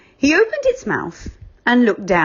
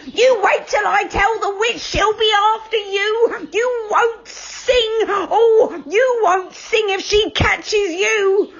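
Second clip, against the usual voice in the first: much higher, threatening storybook voice; angry assistant; 12 semitones higher, slower than usual. Acted. Witch's assistant threatening Joe and friends, shrill-threat high. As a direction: warn them with a wagging finger.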